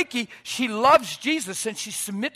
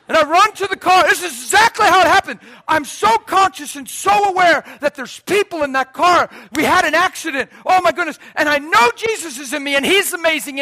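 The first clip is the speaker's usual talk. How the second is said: high, loud, breathless storytelling; excited retelling; 9 semitones higher, 12 dB louder than usual. Real, not acted. Preacher reliving the crash for the congregation, excited and loud. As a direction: tell it all in a rush of excitement.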